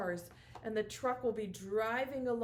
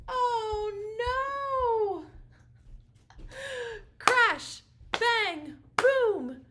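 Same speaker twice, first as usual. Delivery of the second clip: mock alarm; 12 semitones higher, 8 dB louder, much slower than usual. Pretend crash in the rhyme.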